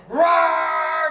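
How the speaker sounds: loud high imitation of a mower starting up; mower engine roar. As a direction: roar out like the engine finally catching. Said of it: "the thing said".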